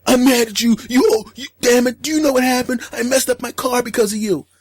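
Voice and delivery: hammed-up girlfriend tantrum; mock angry girlfriend. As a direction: play the furious girlfriend.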